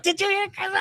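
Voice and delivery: very high, excited call; excited question. Retold or imitated. People at the airport asking about Chris, very high and excited. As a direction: burst out with the news. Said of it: "they're like".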